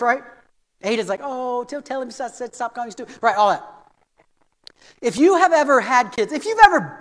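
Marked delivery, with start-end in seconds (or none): 1.2-3.2 much softer, placating murmur; gently smooth it over as the mother; peace-loving mother. Retold or imitated. Mother soothing her upset son, peace-loving. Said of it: "a great peace-loving mother".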